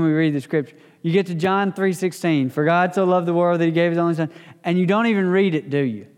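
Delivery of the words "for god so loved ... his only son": fast, rote run through a verse you know by heart; rushed reading. Shown as a demonstration. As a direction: rattle it off without really reading. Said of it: "or you read it fast".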